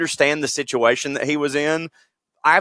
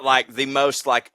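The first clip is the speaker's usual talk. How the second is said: ordinary talk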